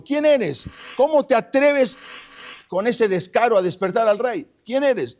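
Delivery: high, loud outraged demand; indignant challenge. Retold or imitated. Woken general Abner challenges the unseen shouter, indignant. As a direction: call back in affronted protest at being woken.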